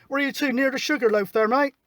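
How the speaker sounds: usual speaking voice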